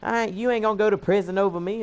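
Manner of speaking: high-pitched mocking imitation of her; flustered woman. Flustered woman daring him over his threat, mock high-pitched indignation. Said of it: "she got all flustered and shit".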